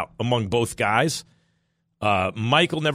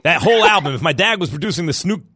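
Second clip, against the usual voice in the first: usual speaking voice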